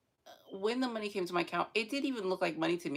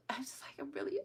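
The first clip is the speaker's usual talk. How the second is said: whisper; 6 semitones higher, 7 dB quieter than usual. Real, not acted. Hushed upset aside.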